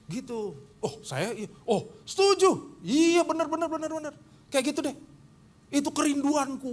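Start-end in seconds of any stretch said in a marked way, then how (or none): 1.6-6.7 eager agreement. Retold or imitated. Preacher mocking his own eager yes to his wife, high. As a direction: gush your agreement.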